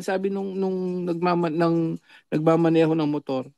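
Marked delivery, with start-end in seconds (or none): none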